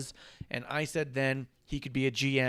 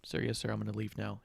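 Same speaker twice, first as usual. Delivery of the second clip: meek quick retreat; 4 semitones lower, 9 dB quieter, faster than usual. Retold or imitated. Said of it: "Cross says".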